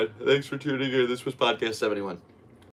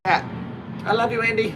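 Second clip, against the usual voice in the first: pet talk; 12 semitones higher, 6 dB louder than usual. Real, not acted. Cooing to his cat.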